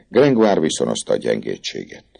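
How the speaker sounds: ordinary delivery, nothing marked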